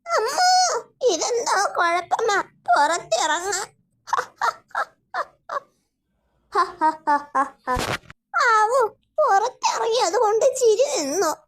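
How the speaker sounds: high witch voice breaking into laughter; a witch who can't stop laughing; witch in a laughing fit. Acted. (ചിരി അടക്കാനാവാത്ത മന്ത്രവാദിനി)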